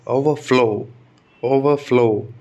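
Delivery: Overflow repeated deliberately, FLOW stressed and falling. Shown as a demonstration. Teacher drilling the verb, weight on the second part. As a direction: lean on the flow at the end of overflow. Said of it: "तो flow पर आएगा".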